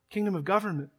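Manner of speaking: normal talking voice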